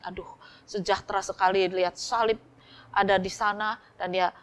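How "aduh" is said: whispered gasp of wonder at the church; a man whispering aduh, overwhelmed by the holy place; awed whisper. Acted. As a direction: breathe the word aduh in hushed awe. Awe on entering the church.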